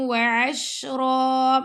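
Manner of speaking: slow chanted recitation; Quran recitation. Shown as a demonstration. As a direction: draw each word out in full recitation. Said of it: "teacher recites Quran verse".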